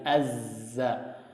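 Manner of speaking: soft buzzing az-za with held z; demonstrated zai. Shown as a demonstration. Teacher demonstrating the zai sound quietly, buzzing. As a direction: buzz the z from the tongue tip. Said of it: "ini huruf zai".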